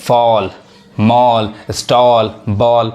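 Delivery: फॉल मॉल स्टॉल बॉल each said with a rounded ऑ, like "fall"; teacher drilling फॉल मॉल स्टॉल बॉल with a clear rounded ऑ; model pronunciation. Shown as a demonstration. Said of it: "यही ए क्या पढ़ा रहा है?".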